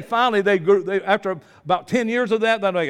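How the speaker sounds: ordinary talk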